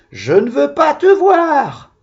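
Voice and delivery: high shrieking mock cry; mock scream. Child in the rhyme shrieking at the spider, mock scream. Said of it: "je crie".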